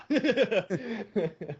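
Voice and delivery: high giggling laugh. Real, not acted. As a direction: crack up laughing. Laughing at Russian bit.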